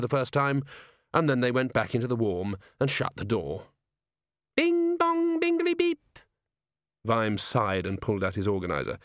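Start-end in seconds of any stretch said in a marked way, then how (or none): 4.6-6.2 high, slow chiming imp voice; giggly sneering imp. A narrator's reading. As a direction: chirp it like a tiny electronic imp. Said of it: "said the imp".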